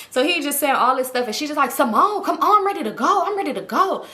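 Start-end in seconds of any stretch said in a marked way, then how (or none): 1.7-4.1 irritated friend. Retold or imitated. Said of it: "looking irritated".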